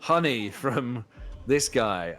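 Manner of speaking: loud, proud ad plug; gladiator sponsor plug. Retold or imitated. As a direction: announce the sponsor to the arena. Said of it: "be like".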